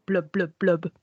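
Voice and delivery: low, soft underwater burble; bubbly forgetful goldfish voice. Pretend. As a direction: gurgle like a fish underwater. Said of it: "What the fuck was that?".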